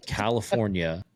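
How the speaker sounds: usual speaking voice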